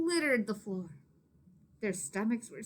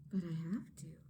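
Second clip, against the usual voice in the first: unvoiced whispered thought; 4 semitones lower, 6 dB quieter than usual. Maria thinking to herself, whispered. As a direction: breathe it to herself.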